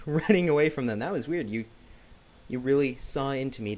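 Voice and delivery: normal talking voice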